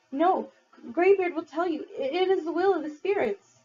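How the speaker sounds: put-on high, urgent pleading boy. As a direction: beg them, pitched high.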